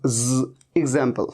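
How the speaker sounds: एग्जांपल with a clear z, drawn out slowly; teacher modelling the z sound slowly. Shown as a demonstration. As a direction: stretch the ज into a buzzing z in एग्जांपल. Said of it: "practice से आएगा".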